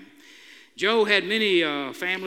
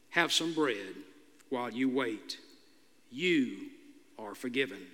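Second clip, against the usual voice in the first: softer, lower kindly offer; gentle offer; 6 semitones lower, 6 dB quieter than usual. A narrator's reading. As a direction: smile through it gently. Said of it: "offered a slight smile and said".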